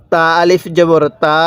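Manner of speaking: spelling chant with stretched last "তা"; drawn-out vowel. Shown as a demonstration. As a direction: hold the final তা long. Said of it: "এক আলিফ টান".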